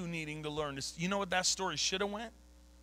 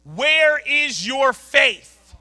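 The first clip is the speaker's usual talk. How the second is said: slow, roaring rebuke, much louder and higher; Christ's booming rebuke; 9 semitones higher, 19 dB louder, much slower than usual. Acted. Preacher booming Christ's words at doubting disciples, roaring and slow. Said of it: "You know what Christ said to him?".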